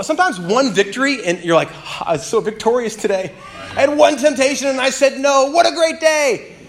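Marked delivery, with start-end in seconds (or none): 1.7-6.6 mock triumphant. A believer boasting over one resisted temptation, mock triumphant. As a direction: crow about one small win.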